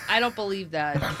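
ordinary talk, nothing marked

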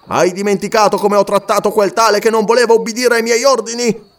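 minacce gridate, voce molto più alta; furore minaccioso. Acted. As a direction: minaccia di morte il Wakwafi. Warrior shaking and threatening porter. (Umslopogaas scuote il Wakwafi e lo minaccia, furioso)

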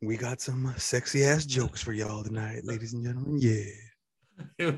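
slow, smooth DJ patter; late night radio voice. Pretend. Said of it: "late night radio voice".

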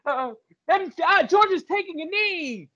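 high-pitched, gleeful mock announcer cries; hammed-up exultation. Imagined gleeful play call of a rout.

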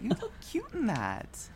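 teasing high voice; high playful voice. Real, not acted. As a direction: sing it up high. Playful compliment.

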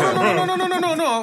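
squealing no no no; excited protest. Real, not acted. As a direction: cut him off, laughing in disbelief. Protesting in argument.

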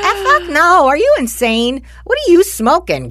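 high, loud "no way" outburst; incredulous refusal. Retold or imitated. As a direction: refuse the son flat out. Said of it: "I'm like".

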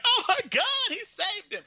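giggly overjoyed fan; mock thrilled relief. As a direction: squeal with laughing delight. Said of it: "And you're like".

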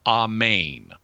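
AMEN as "ah-MAYN", short a, long second vowel; Greek pronunciation. Shown as a demonstration. Host explains the Greek New Testament word, AMEN as ah-MAYN. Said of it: "it should be pronounced something approximating".

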